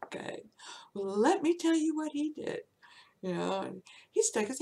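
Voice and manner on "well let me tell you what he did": high, loud declaring; mock yell. Secretary telling the office what the boss did, loud. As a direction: announce it to the room. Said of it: "the one I was screaming".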